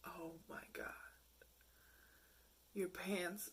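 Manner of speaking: hushed, breathy disbelief; shocked whisper. Retold or imitated. Reacting to a man's tight pants, a shocked whisper. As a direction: whisper it like you can't unsee it.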